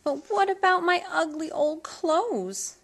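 worried little plea; young Cindy asking anxiously; Cindy's voice. A narrator's reading. Cindy asks.

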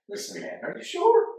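high incredulous questioning; doubting a friend's story, high and loud disbelief; skeptical. Retold or imitated. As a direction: press him like you don't buy it. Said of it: "Aaron, and be like".

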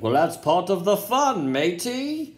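breezy mock British accent, tossed off; lightly voiced British accent, unbothered. Statham brushes off danger in a breezy mock British accent. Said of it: "have a British accent".

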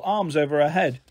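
plain reading voice

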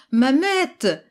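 high, loud call down the corridor. A narrator's reading. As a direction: call his wife from the back of the room. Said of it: "en appelant". (appel lancé fort vers le fond du couloir)

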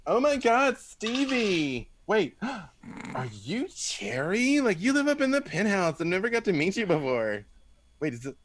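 high, squealing starstruck fan; excited young woman. Acted. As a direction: gush over meeting Cherry. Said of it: "she goes".

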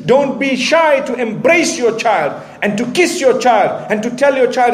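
ordinary talk

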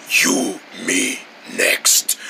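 very low, halting growl; menacing big opponent. Acted. Big opponent challenges the scared onlooker, deep menace. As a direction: growl a threat word by word.

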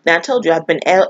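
normal talking voice

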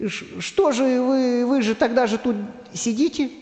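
high, halting, puzzled voice; hesitant stutter, pitched high; doubtful stammering. Acted. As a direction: stumble over the question in doubt. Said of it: "засомневался Карандаш".

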